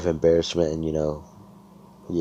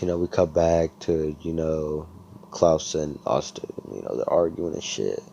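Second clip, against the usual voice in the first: ordinary delivery, nothing marked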